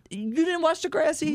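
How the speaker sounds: high shocked question; stunned someone missed it; incredulous. Real, not acted. Disbelief friend never watched.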